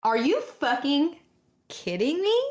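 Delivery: slow, rising incredulous question; real frustration at bad luck; exasperated disbelief. Real, not acted. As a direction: draw it out, pitch climbing in disbelief. Reacts to a bad dice roll.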